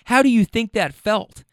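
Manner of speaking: ordinary talk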